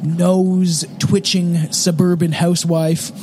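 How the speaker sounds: documentary narration. Acted. Reads intro over music.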